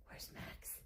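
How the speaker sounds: teasing whisper to the cat. Shown as a demonstration. Showing how she whispers to hype her cat. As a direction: breathe it as a secret. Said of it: "She gets hyper when I say".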